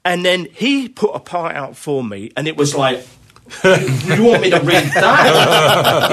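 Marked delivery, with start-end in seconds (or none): 3.6-6.1 mock disbelief, loud and drawn out, on the edge of laughing; incredulous. Drummer balking at the part put out for him, incredulous. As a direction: laughingly balk at the task. Retold shock at hard part.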